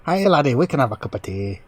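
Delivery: hammed-up Irish brogue, lightly done; an attempt at Irish that drifts Scottish; bad Irish accent. Friend joking about British voices, mock Irish brogue. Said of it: "That's not British".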